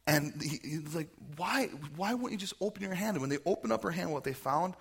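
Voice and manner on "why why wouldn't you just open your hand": higher-pitched, baffled complaint; exasperated uncle. Acted. Uncle finds niece's fist was closed all along, exasperated. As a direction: plead in acted exasperation.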